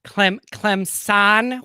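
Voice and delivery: CLEMSON drawn out with a z and a long "sahn". Real, not acted. Co-host tries out the disputed word, slow questioning Clemson with a Z. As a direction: say Clemson slowly, second syllable like "zahn". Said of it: "problem with people using the word".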